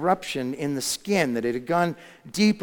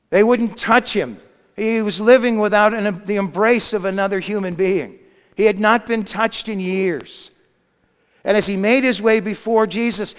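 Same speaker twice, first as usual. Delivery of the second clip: raised, urgent sermon storytelling; impassioned preaching; 4 semitones higher, 6 dB louder than usual. A narrator's reading. Preacher telling of the untouched leper reaching Jesus, building with force.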